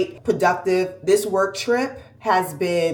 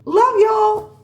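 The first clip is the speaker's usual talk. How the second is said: sweet brush-off; 14 semitones higher, 4 dB louder than usual. Retold or imitated. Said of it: "I'd be like".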